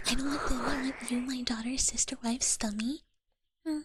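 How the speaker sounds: ordinary delivery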